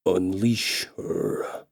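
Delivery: slow, low, stern orc command; raw hoarse orc order. Acted. Orc king ordering his guards, slow stern rasp. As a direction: drag it out, low and hard, like a brute king. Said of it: "in a raw, hoarse voice and stern tone".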